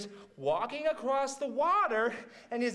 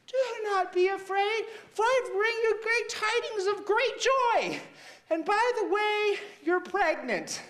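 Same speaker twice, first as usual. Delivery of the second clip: high, grand angel announcement; 9 semitones higher than usual. Retold or imitated. Angel appearing to Eve, high and grand. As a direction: proclaim it high like a heavenly messenger. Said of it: "this angel comes out of nowhere and was like".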